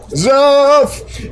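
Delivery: high, loud, long-held name call; playfully loud shout. Pretend. Streamer playfully greeting a viewer after apologizing, drawn-out shout. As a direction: belt out the name, stretching it. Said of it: "Sorry that was so loud".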